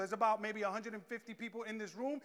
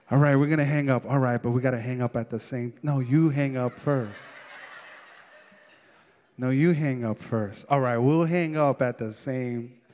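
giggly late night call; 10 semitones lower, 11 dB louder than usual. Retold or imitated. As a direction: tease back and forth, low and giggly. Lovers won't hang up.

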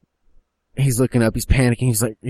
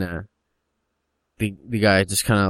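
normal talking voice

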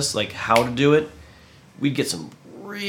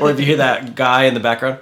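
usual speaking voice

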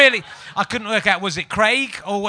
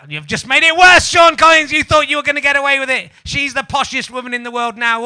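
much higher, louder shouted telling-off; mock outrage; 7 semitones higher, 10 dB louder than usual. Telling off the listener who emailed, a mock furious shout. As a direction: bellow at Sean Collins in fake fury.

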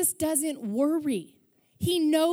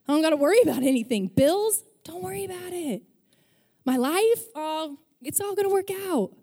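breezy, higher, carefree voice; carefree Jesus; 4 semitones higher than usual. Retold or imitated. Preacher voicing a carefree Jesus skipping through fields, breezy and high. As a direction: skip through it like nothing matters. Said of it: "skipping through fields because he's like".

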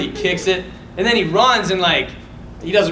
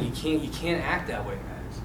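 soft, lowered retold correction; gentle dad; 6 semitones lower, 10 dB quieter than usual. Dad correcting son after the fight, quietly imitated.

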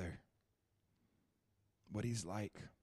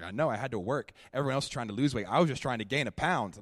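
retold self, louder; 3 semitones higher, 8 dB louder than usual. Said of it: "And I was like".

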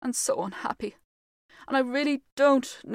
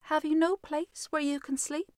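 ordinary delivery

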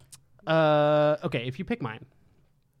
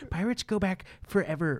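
a hint of an accidental Trump voice; vaguely Trump; 5 semitones higher than usual. Host correcting a pirates guess, drifting into a vague Trump voice. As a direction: slip slightly into a higher-pitched Trump-like voice. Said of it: "vaguely Trump for some reason".

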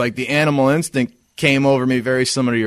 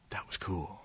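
hushed whisper, almost no voice; whispered awe; 5 semitones lower, 21 dB quieter than usual. Retold or imitated. Friend quietly praising Nick after the fight, a whisper. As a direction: breathe it like a secret compliment. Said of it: "But he's like".

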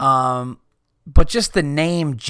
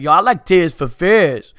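put-on Brooklyn accent; 4 semitones higher, 5 dB louder than usual. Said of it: "be like".

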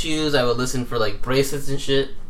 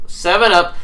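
higher, louder punchline; excited pun callout; 6 semitones higher, 6 dB louder, slower than usual. Shown as a demonstration. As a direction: sell the pun.

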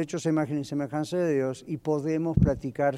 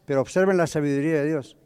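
ordinary delivery

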